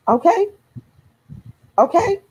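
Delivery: high, rising okay that demands agreement; insistent okay. Real, not acted. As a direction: pitch it up high, daring them to disagree. Pressing her audience to agree.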